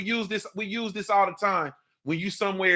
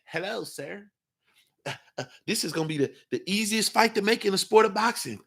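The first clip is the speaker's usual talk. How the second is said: a hushed, much softer, timid mock of a boxer; mock the meek boxer's soft greeting; soft mocking impression. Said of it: "Shut that soft shit up".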